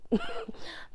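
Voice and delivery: short breathy laugh; quick airy laugh. Real, not acted. Laughs at the toxic turn.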